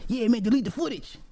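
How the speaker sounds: short guy's protest. Retold or imitated. Jazz telling the owner to delete the footage, high and indignant.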